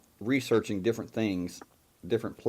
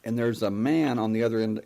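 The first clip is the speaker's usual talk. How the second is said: ordinary talk, nothing marked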